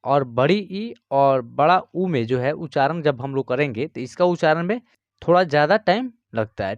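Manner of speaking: ordinary delivery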